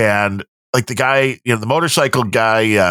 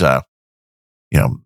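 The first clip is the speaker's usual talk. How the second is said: usual speaking voice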